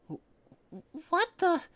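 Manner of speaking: startled high stammer; stammering Twilight. Acted. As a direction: stumble over the words in shock. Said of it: "stammered Twilight".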